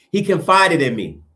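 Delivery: ordinary delivery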